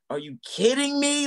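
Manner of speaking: high, slow, disbelieving plea to the sky; exasperated at God. Retold or imitated. Telling the host how he was mad at God, exasperated plea. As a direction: drag it out like you can't believe it.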